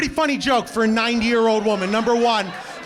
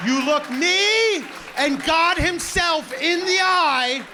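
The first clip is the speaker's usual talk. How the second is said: solemn accusation; 8 semitones higher, much slower than usual. Real, not acted.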